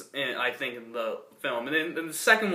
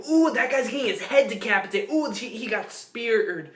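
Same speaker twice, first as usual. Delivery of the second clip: gleeful action fan; 7 semitones higher than usual. Retold or imitated. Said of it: "so people can say".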